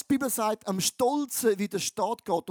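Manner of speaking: normal talking voice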